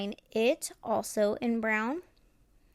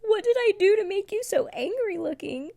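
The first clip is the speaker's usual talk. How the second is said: mock dismay; 9 semitones higher, 4 dB louder than usual. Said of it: "And I was just like".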